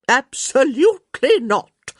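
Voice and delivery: "Absolutely not", slow, high and loud; emphatic. A narrator's reading. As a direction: stretch and punch every beat of "Absolutely not". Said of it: "Lady Danbury said emphatically".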